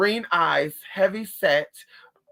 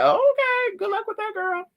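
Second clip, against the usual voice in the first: high, sassy mock dismissal; high-pitched mock good luck, girl; sassy brush-off. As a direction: flick her off with a high sing-song sass. Sassy dismissive reply in retold chat.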